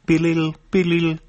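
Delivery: high electronic beeping ring; ringing device beeps. Acted. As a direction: chirp it like a tiny phone ringing.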